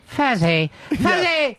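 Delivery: drawn-out, mock calling of a name; bad Ron impression. Host mimics Tommy Z's bad impressions, exaggerated name-calling. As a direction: badly imitate a radio host calling his partner. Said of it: "doing the bad impressions of Ron and Fez".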